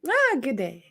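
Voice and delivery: another light go at an Australian greeting; a half-hearted Aussie "Ah, good day"; Australian accent. Shown as a demonstration. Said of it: "That was my big impression".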